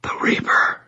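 hushed, dread-filled whisper. A narrator's reading. Terrified girl names her attacker, whispering. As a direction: whisper the name without any voice. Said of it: "Her voice dropped to a whisper".

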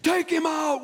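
high, loud mock yell; hammed-up rallying shout; revolutionary mob cry. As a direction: shout it like a riled-up crowd. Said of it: "pretty soon there's a whole revolution".

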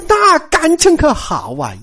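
high, delighted exclamation; pleased outburst, pitched well up. A narrator's reading. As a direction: exclaim it high, as if slapping your thigh. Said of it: "一听是直拍大腿".

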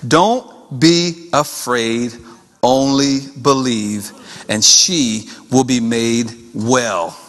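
very slow, low and measured; solemn reassurance. Retold or imitated. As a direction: reassure with weighty pauses between phrases. Jesus reassures the grieving father.

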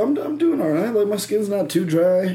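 high-pitched mock protest; defensive protest. Answering the saleswoman's criticism, defensively protesting.

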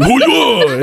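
video game attack yell; fighter battle cry. Retold or imitated. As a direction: shout it like a combo move. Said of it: "they would".

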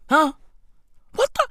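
high squeaky outraged disbelief; shrieking the fuck, stunned; shocked disbelief. Real, not acted. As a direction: pitch way up in total disbelief.

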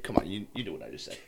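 quick, low, soft run-together quote; slurred words. Retold or imitated. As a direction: slur all the words of "you knew what I just said" into one rushed mumble. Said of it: "I'll slur my, all my words together".

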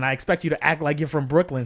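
ordinary talk, nothing marked

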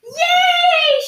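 very high, loud yay; shout. Acted. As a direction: shout for joy. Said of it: "shouted Michael".

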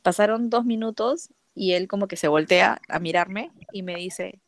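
usual speaking voice

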